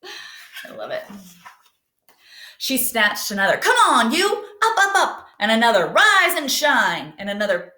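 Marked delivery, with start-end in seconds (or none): 3.6-5.3 high, loud bossy calls; bossy yell. Acted. Camila yelling at leaves. 5.9-7.1 cheery wake-up call. Acted. Camila waking leaves.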